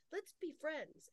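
high, soft coaxing voice; sweet little invitation, put on; mock-kind. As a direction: imitate a falsely friendly spirit. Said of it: "they'll be really kind".

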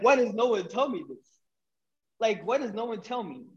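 high, softened replay of exasperation; exasperated plea. Retold or imitated. Retold upset plea after learning of lies.

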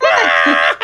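loud mock shriek of laughter; imitated outburst. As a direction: imitate her bursting out loudly. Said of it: "She was like".

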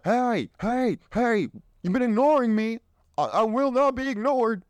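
high, insistent mock demand; biology nagging. Biological clock nagging a woman near thirty, high insistent voice. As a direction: nag like a ticking body clock. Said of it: "Their biology hits them".

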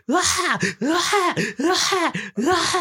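repeated very high shrieks of fright; retold scared screams. Scared by the jump scare in bed, high-pitched screams. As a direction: shriek again and again in terror. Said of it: "I started screaming like".

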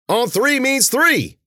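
slow, high, loud scolding; angry snarl. A narrator's reading. Warner scolding Mueller for throwing late, snarling. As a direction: drive each word home furiously. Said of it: "Warner snarled".

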